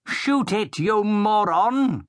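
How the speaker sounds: high, slow, contemptuous yell; angry order. A narrator's reading. The Baron yells at Blood to shoot the troll. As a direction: bark it at the fool.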